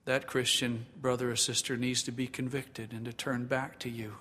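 quiet, subdued pastoral prayer; public prayer. Acted. Pastor praying to the Father for believers, quiet prayer. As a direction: pray softly and evenly, in measured phrases.

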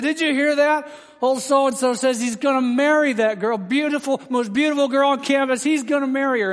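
mocking jeer. Students laughing at classmate's boast, mocking jeer. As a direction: jeer in a high, incredulous voice. Students mock the young man.

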